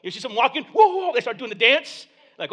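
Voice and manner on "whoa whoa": high, loud mock alarm; comic panicked outburst; startled yelp. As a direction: act out jumping away from a bee. Imitates walker startled by bee.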